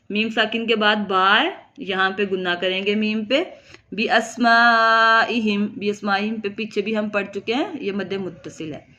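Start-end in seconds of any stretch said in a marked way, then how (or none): 3.9-5.7 slow, drawn-out Quranic chant with held vowels; Quran recitation. Shown as a demonstration. Teacher reciting the verse for students, slow tajweed chant. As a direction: recite it slowly like tilawat. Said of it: "teacher recites verse in tajweed".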